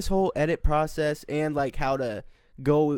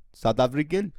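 South African accent. Shown as a demonstration. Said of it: "how's your South African accent".

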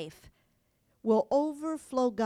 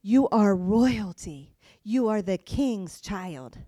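slow, lower, deliberate blessing; slow lower affirmation; 4 semitones lower, 3 dB louder, slower than usual. Real, not acted. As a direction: tell each listener they matter. Tender affirmation to congregation.

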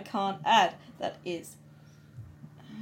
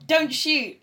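raised, higher-pitched cry of surrender; surrender yell; 7 semitones higher, 5 dB louder than usual. Acted. Whisper surrendering to armed guards, yelling. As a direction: call it out louder, hands up. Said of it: "symbol of surrender and yell".

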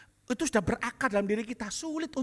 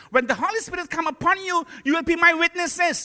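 scripture quote; 10 semitones higher, 8 dB louder than usual. Acted. Quoting scripture in English.

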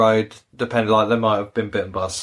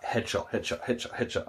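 much softer, under the breath; hushed repetition; 12 dB quieter than usual. Retold or imitated. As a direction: tick them off quietly, shot after shot. Mimics rapid shooting in game.

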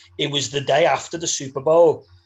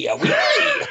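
"oui" squealed very high and loud; different pronunciation; 5 dB louder than usual. Retold or imitated. Hotel receptionist in conversation, imitated with a high squealed "oui". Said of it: "she was pronouncing it different".

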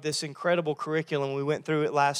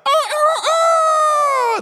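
very high, loud rooster crowing; 11 dB louder, much slower than usual. Acted. Pastor imitating the rooster after Peter's denial, loud crow. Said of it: "chicken interpretation".

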